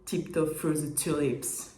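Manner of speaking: playful fake anglophone accent; mock English accent. Shown as a demonstration. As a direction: show off a put-on English accent, slowly. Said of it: "je vous le refais".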